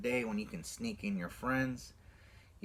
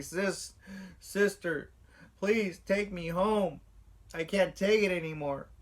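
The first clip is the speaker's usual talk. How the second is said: put-on high, slow, whimpering plea of a scared child; tearful pleading; 7 semitones higher, 4 dB louder than usual. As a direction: beg your big sister to take you home, near tears. Scared kid begging sister.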